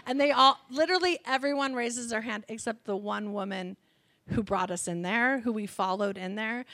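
usual speaking voice

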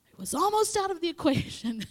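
high, loud comic outburst; mock alarm. Corrected child realizing the close call, comic alarm. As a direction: blurt it high and loud, hamming it up. Acting out narrow escape, comic.

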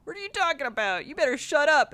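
hammed-up thug snapping back; tough guy retort. Cornered thugs snapping at the stranger, mock tough.

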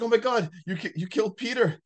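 lightly acted frantic accusation; retold freak-out. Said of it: "she starts losing her mind".

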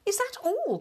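high-pitched dismay; disappointed cry. A narrator's reading. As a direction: question the meagre offer in high disbelief. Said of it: "cried the Town Mouse, looking disappointed".

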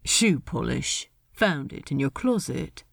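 low, soft laconic voice; Ed, offhand. Acted. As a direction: answer low and casual. Ed's offhand reply.

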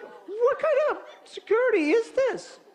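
very high, slow, exasperated squeal; incredulous. Retold or imitated. Homeowner appalled by the helpful alarm man, incredulous. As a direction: stretch the question out high in disbelief. Acted disbelief at security check.

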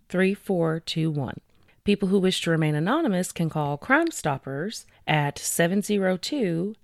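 normal talking voice

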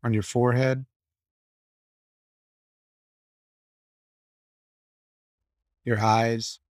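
low, even meditation voice with long pauses; guided meditation. Acted. Guiding the group to relax and release tension, calm meditation voice. Leads a guided body scan.

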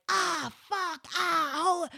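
high, loud re-enacted howls of pain; cries of pain. Woman on her knees with back out, howling in pain. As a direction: yell out as if the back just gave out. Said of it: "writhing in pain".

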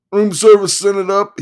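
high, loud manic lawyer; excited manic attorney. Acted. Attorney voiced.

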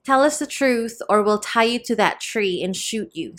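ordinary delivery, nothing marked

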